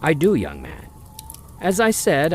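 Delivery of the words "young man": ordinary talk, nothing marked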